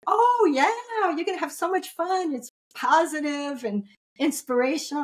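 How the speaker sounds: high, sing-song gushing, mimicking an eager promoter; gushing enthusiasm. Lady talks her into coming to an event, gushing.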